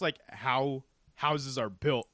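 usual speaking voice

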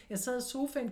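ordinary delivery

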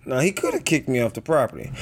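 ordinary talk, nothing marked

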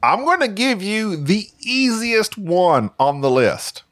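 high, slow game-show host voice; playful host teasing the contestants; quiz show host. Pretend. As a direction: announce it like a TV quizmaster.

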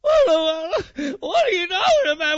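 high, wailing mock cry; mock whining. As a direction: imitate a whiner bawling in protest. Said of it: "quit your whining about it".

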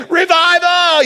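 high, loud triumphant cry; excited shout. Retold or imitated. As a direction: yell it like a rallying call. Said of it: "And Chris is like".

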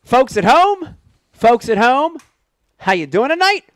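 big, high announcer welcome; show host announcer. Acted. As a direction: greet the viewers like a showman. Host opens live show.

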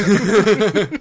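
very high, loud rising cheer; cheerleader. Pretend. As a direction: squeal the last word. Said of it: "like a cheerleader".

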